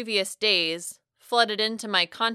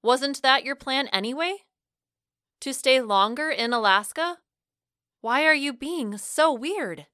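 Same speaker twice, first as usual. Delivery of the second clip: higher, frustrated questioning; rising frustration; 6 semitones higher than usual. A narrator's reading. Narrator challenges Franco's change of plan, frustrated. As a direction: push back in frustration, pitch climbing on each question. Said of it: "Frustration rose from my breast and into my throat".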